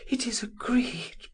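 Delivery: high whispered gasp; woman whispering agreement, breath caught. A narrator's reading. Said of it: "She gasped".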